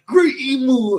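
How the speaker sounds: high, loud mock scream; Saint Saturn screaming. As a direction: shriek it out as the dying elder. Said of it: "Saint Saturn is just screaming".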